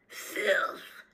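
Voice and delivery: growling contempt for the prisoner. A narrator's reading. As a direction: spit the word out in a growl. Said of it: "growling voice say".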